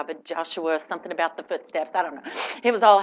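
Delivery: ordinary talk, nothing marked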